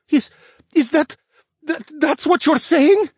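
very high, loud, frightened stammer; alarmed bear cub tripping on his words. A narrator's reading. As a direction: stumble over the words in panic. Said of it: "Gio stammered".

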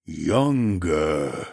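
long, low, falling drone; droning slothman. A narrator's reading. Slothman answering about his brother, slow drone. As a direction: drag the word out slowly and low. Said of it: "he droned".

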